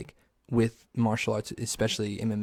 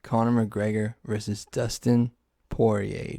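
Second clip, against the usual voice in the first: ordinary talk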